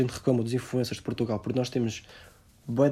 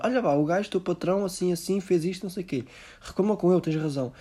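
higher pushy friend voice; mock urging friend; 6 semitones higher than usual. A friend urging a resigned Portuguese to complain, higher and pushy. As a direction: nag them to complain, a little higher. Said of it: "somos assim para tudo".

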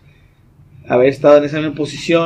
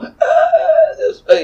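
very high laughing yell, half a cry; laughing fake scream; mock dream scream. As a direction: scream for help in a nightmare, laughing through it. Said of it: "según yo en mi sueño estoy gritando".

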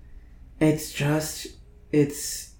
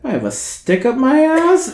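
higher pitched, rising question; mock high quote; 10 semitones higher than usual. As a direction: squeak it like an indignant friend. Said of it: "She's like".

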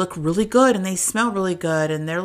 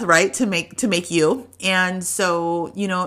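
ordinary delivery

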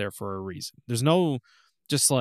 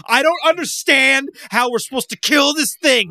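high, loud, baffled yell at the boss; exasperated gamer outcry; 13 semitones higher, 10 dB louder than usual. Retold or imitated. Gamer stuck on a boss, loud baffled yell. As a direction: throw up your hands, hammed-up disbelief. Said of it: "you're just like".

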